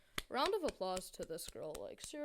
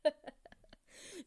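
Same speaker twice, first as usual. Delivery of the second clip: breathy laugh; 8 semitones higher than usual. Real, not acted.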